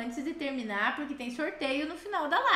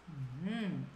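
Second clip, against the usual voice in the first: ordinary talk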